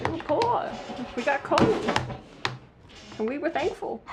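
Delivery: high-pitched mock hard-luck tale; put-on poor-child lament; mock pitiful. Mock sob story about childhood.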